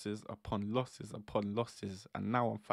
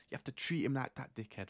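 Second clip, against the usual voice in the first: ordinary talk, nothing marked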